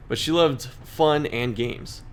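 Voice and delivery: slow, halting read-through; reading very slowly. Real, not acted. Reading the game text aloud, very slow and halting. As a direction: drag each word out, stumbling over the text. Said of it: "Very, very slow here".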